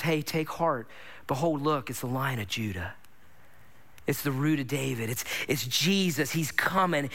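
low, softened voice of a comforter; gentle reassurance. Retold or imitated. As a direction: retell it as a hushed, tender consolation. Retold comforter taps weeping John's shoulder.

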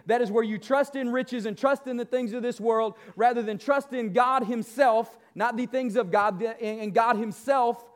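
ordinary talk

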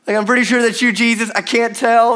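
half-sure disciple straining to see across the water; unsure Peter. Acted.

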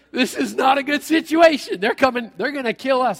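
a frightened servant pointing at the army; panicked servant. Retold or imitated.

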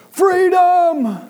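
high, loud yelled battle cry. Retold or imitated. Little brother defying his sibling, yelling high and loud. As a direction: belt it out like a rebel. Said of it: "like, very loudly".

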